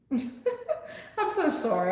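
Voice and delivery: slow, laughing through the sorry; laughing apology. Real, not acted. Tarot reader laughs while apologizing to viewer for yelling.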